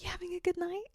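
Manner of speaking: mock her tight little question under the breath; whispered imitation of a tight-voiced woman; pinched hushed voice. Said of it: "tight ass voice".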